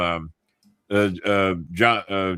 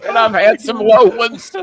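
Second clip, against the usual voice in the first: laughing; 11 semitones higher, 9 dB louder than usual. Retold or imitated. Echoing the guest's line while laughing.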